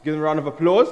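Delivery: loud, high host call; calling for applause. Real, not acted. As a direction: pump up the crowd.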